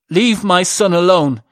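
loud, high protective shout. A narrator's reading. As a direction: shout it as a demand. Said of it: "shouted Darek".

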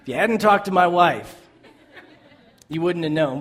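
amused and loud; laughing paraphrase. Retold or imitated. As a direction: laugh while translating the jab. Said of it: "really mean way of saying".

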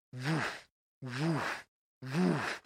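mock wave-machine woof sound; imitated wave whoosh.

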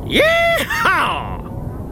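high cowboy whoop; hearty yeehaw. Acted. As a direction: whoop it out like a rodeo rider. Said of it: "with a hearty".

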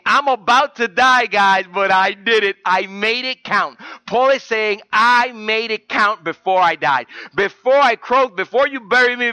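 fervent sermon climax; preaching at full force. Acted. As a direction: drive it home with fervor.

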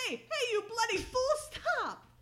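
high, loud shout at a cabbie; hammed-up yelling after someone to stop; imitated angry yell. Hails a cab in a chase.

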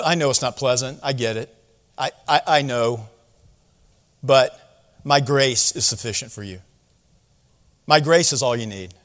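low, soft, tender voice; gentle reassurance. Acted. God comforting Paul about his hardship, gently reassuring. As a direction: reassure softly, with pauses between phrases.

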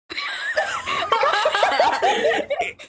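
a real laugh breaking out; high burst of laughter. Real, not acted. Laughing hard at her own tease about the master's.

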